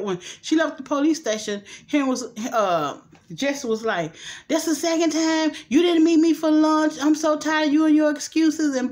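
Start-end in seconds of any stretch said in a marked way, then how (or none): 4.5-8.7 high, loud mock tirade; fed-up complaint. Boyfriend on the phone over missed lunch, fed up. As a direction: rattle off the grievances, raised and exasperated.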